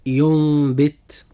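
ينبت drawn out slowly, the n turned into a nasal m before the b; slow tajweed demo. Shown as a demonstration. Teacher slowly spelling out a word for listeners, drawn-out recitation. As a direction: stretch ينبت slowly so the iqlab is heard. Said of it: "كلمة".

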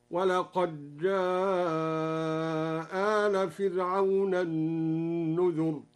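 "ولقد جاء آل" with dal merged into jim, one hamza dropped, long-held aa; very slow recitation, waqaj-jaaaa-aal, stretch "جاء" long; merged dal, long madd. Shown as a demonstration. Teacher showing al-Duri's reading, "ولقد جاء" merged, one hamza, long madd. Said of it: "وقرأ بإسقاط إحدى الهمزتين على القصر والمد".

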